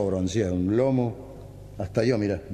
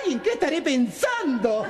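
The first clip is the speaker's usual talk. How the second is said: much higher camp caricature voice; 14 semitones higher than usual. Acted. Camp man to the big neighbour, caught out, much higher voice. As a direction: play the flustered camp man.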